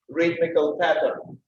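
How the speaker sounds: ordinary delivery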